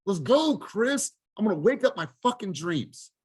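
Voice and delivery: high, rising hype to yourself; pumped-up self talk. Pretend. Coach to team, acting out waking his dreams: pumped-up shout. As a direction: fire yourself up, calling out names. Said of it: "I'm gonna literally close my eyes and like".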